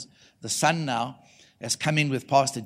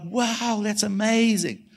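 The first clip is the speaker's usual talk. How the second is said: high, drawn-out wonder; acted amazement; 8 semitones higher, much slower than usual. Preacher hearing of the healing, high and amazed. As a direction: stretch it out high in awe.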